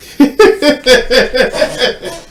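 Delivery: loud high-pitched giggling burst; high burst of laughter. Real, not acted. Laughing at his partner's vague answer.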